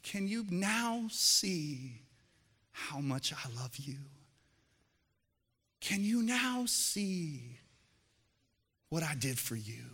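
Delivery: tender loving words. Shown as a demonstration. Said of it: "No, it's".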